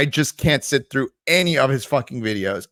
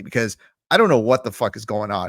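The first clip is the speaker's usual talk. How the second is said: ordinary talk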